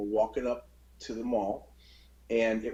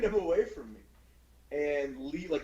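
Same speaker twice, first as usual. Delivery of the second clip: normal talking voice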